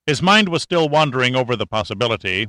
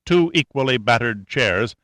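usual speaking voice

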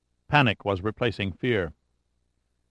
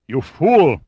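sudden higher, louder outburst; blurted outrage; 4 semitones higher, 6 dB louder than usual. A narrator's reading. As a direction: blurt it out as the realization strikes. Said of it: "Lugovoy blurted as the full realization".

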